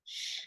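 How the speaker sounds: "sh" as a quiet voiceless hiss; sh sound. Shown as a demonstration. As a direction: model a soft "sh". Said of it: "I do".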